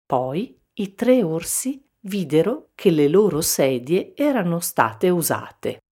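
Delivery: ordinary delivery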